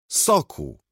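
high, loud sudden shout; sudden yell. Acted. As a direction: burst out yelling without warning. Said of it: "wrzasnął znienacka". (nagły, wysoki wrzask)

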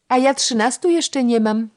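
high, loud gleeful boast; triumphant. A narrator's reading. Youngest girl boasting of her age to the elder, triumphant. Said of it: "z triumfem rzuciła Ewunia".

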